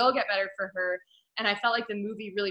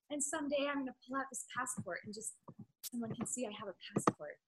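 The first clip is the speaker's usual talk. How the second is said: much softer, small hopeful wife; meek wife voice; 3 semitones higher, 14 dB quieter than usual. Retold or imitated. Imitating the downtrodden wife about her passport, soft and meek. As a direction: say it like a timid housewife dreaming. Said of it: "She's like".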